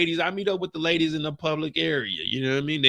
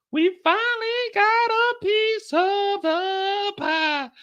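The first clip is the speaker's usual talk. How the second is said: much higher, slow, put-on boast; Tariq triumphant; much slower than usual. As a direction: crow about the win. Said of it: "So Tariq telling Tasha".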